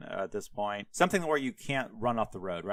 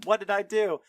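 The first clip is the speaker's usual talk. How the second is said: higher, louder retort in a retelling; imitated indignant comeback; mock snapping back; 9 semitones higher, 5 dB louder than usual. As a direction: snap back like an affronted donkey. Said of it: "snapped back to him".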